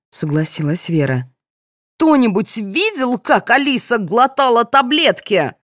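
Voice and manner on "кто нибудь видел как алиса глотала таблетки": high, slow indignant squeal; shrieked challenge. A narrator's reading. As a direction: squeal the question in outrage. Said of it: "взвизгнула Галина".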